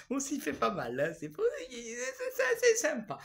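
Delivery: very high, squeaky giggle through the words; giggling. Real, not acted. Vlogger joking about getting used to it, helpless high giggle. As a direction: barely get the words out for giggling.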